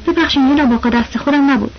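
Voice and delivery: usual speaking voice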